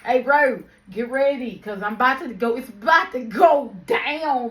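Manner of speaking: loud, high hyped-up call; rally him for a fight; hyped warning. Retold or imitated. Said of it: "I said".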